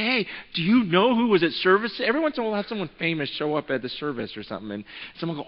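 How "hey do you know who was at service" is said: imitating an eager gossip, high and loud; mock-breaking the big news; starstruck excitement. Churchgoer telling pastor about a famous visitor, excited.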